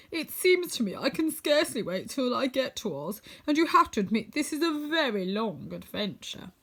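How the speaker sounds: Tin Woodman. Acted. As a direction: tin man's weary complaint, lightly played.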